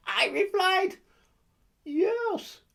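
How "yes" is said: put-on a quick, higher Russian-accented yes. Russian woman in the club answering, in a Russian accent. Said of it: "he said in her Russian accent".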